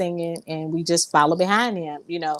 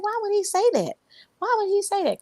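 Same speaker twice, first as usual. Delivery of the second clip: high, pleading question; high hurt "why would he say that"; hurt disbelief; 14 semitones higher, faster than usual. Retold or imitated. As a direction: ask it high as if wounded. Retelling her hurt confusion.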